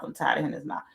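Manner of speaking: mom's tired complaint, now dropped right down. Retold or imitated. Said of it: "my mom was like".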